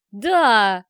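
loud, long-drawn gleeful shout; enthusiastic yell. A narrator's reading. Fathers gleefully back the child's question, yelling. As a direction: bellow it with obnoxious enthusiasm. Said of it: "С отвратительным энтузиазмом заорали папашки".

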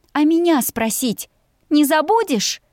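high-pitched indignant question; outraged, pitched well up. A narrator's reading. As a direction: let the voice leap up, ringing. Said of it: "Мой голос стал выше и звонче".

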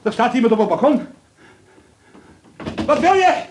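panicked, high calls into the dark; frightened cry. Acted. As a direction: call out in sudden fear at a shape outside. Sees figure on balcony.

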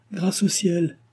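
high, bright cry of thanks; a lightly voiced girl crying out thank heaven; relieved exclamation. A narrator's reading. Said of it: "s'écria Henriette".

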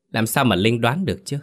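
ordinary delivery, nothing marked

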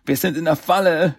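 high, dismayed groan. A narrator's reading. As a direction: groan it out in despair. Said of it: "stöhnte Mike". (gequält stöhnend)

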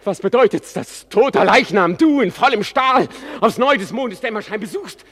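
agitated pleading. Acted. Hamlet urgently questioning his father's ghost. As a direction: press the ghost for answers, rising in pitch.

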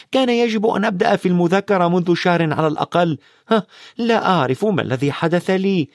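put-on high-pitched, incredulous girl's voice; agitated studious girl. Hermione frets to Ron about exams, high and agitated. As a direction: fret urgently in a high voice.

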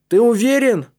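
high, loud question; dismayed calling. A narrator's reading. Boy below asks Матиас if he is sure, dismayed. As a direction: call up in disbelief. Dismayed friend calls up to check.

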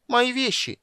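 high whiny squeal; plaintive squeal. Acted. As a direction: squeal it pitifully. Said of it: "жалобно запищал Кендер".

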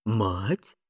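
short, low questioning repeat; baffled little question; puzzled echo. A narrator's reading. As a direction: echo the word in mild puzzlement. Puzzled policemen echo the curse.

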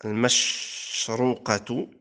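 المشروقة drawn out slowly with "u" after the ra; damma on the ra. Shown as a demonstration. Teacher showing the second word's forms, damma on the ra of المشروقة. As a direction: sound المشروقة as mash-RU-qa. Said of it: "والكسر والضم".

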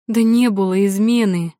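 loud indignant denial; furious outburst. Acted. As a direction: snap back in rage. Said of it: "взбеленилась девушка".

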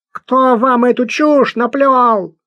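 высокий, громкий гнусавый бродяга; возмущённо отпираться наглым бродягой; возмущённый бродяга. Acted. Персонаж бродяга. (гнусавый бродяга отпирается перед Луи)

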